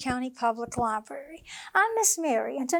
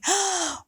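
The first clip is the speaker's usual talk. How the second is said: a sharp little gasp of surprise; surprised gasp; 4 semitones higher than usual. Acted. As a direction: gasp as if seeing a wonder. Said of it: "Squirrel gasped".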